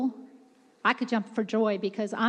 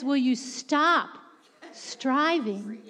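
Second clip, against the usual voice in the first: slow, gently lowered voice; gentle urging; much slower than usual. Retold or imitated. Holy Spirit to a striving preacher, gently. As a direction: ease off slowly into a tender plea. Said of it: "ever so gently says".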